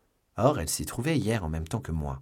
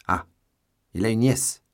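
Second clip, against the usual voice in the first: interrupting; 5 semitones higher, 6 dB louder than usual. A narrator's reading. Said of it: "interrompit Porthos".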